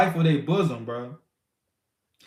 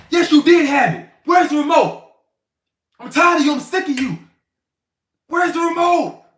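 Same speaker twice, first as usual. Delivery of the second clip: high, loud angry shouting at his wife; furious husband shouting; 11 semitones higher, 7 dB louder than usual. Retold or imitated. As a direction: explode at her over the remote.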